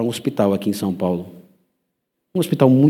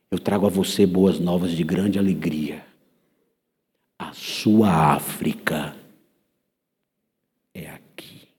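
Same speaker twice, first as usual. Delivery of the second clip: whisper; 3 semitones lower, slower than usual. Retold or imitated. Jesus speaks to dying Simone.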